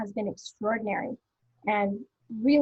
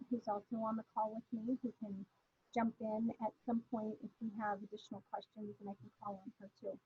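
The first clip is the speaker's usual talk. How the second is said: distant speakerphone; 11 dB quieter than usual. Real, not acted. Switched to speakerphone mid-call.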